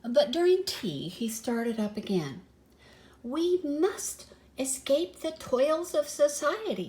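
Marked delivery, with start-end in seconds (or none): 3.2-6.9 lightly voiced pompous cat, higher; declaring grandly. A narrator's reading. Humphrey urges the mice to flee society, grandly declaring. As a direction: proclaim it like a lofty idealist. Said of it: "he declared".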